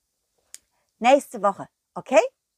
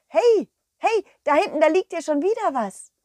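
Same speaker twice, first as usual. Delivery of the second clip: higher, louder, fast excited calling; 6 semitones higher, 4 dB louder than usual. Acted. The dog calling to the cow about a new find, excited. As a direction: point it out eagerly, voice up. Dog spots something, calls out.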